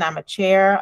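ordinary talk

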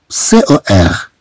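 ordinary talk, nothing marked

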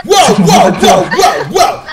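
loud, rapid-fire protest; mock-panicked bro shout; mock alarm. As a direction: cut in with loud hammed-up objections.

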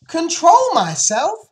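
very high, slow, loud echo; yelled disbelief. Acted. Fern answers her father's advice, yelling in disbelief. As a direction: yell it back, outraged. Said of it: "yelled Fern".

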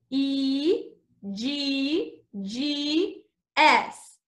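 E G G S spelled very slowly, letter by letter; slow spelling. Shown as a demonstration. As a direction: draw out each letter for the kids. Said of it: "Let's spell eggs".

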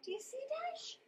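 plain reading voice

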